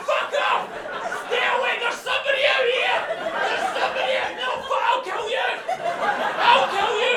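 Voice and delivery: screeching high, loud dog yelling through laughter; dog shouting in English. Pretend. Comedian voices his dog yelling at the postman, screeching. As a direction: scream it like a frantic guard dog. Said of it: "I know she's just going".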